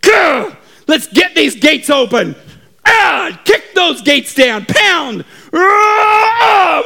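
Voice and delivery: high, straining yells; battle cry. Acted. Our army kicks down the enemy gates, straining shouts. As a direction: holler with effort while battering gates.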